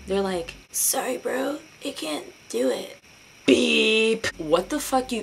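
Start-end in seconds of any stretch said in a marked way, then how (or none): 0.7-3.0 high, breezy brush-off from a flaky friend; higher put-on voice of the friend bailing out; mock flaky friend. Mocking the friend who bails. 3.4-4.3 a short loud beep to cover a swear; spoken censor beep sound; mock censor beep. Censor bleep imitation.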